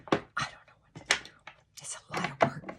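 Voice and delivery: whispered aside to herself; self-pitying whisper under her breath. Real, not acted. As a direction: breathe it out as a tired joke. Muttering to herself about adjusting.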